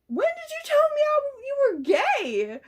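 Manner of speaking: high, loud, fast shriek of disbelief; flipping out. Retold or imitated. Retelling how she screamed at her friend, high loud shrieking disbelief. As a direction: shriek the question, outraged. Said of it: "I was flipping out".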